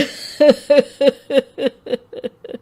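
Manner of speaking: a broken sob trailing down; old father's tearful sob. Acted. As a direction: let out a long grieving sob. Grieving father after lament.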